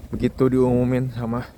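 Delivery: usual speaking voice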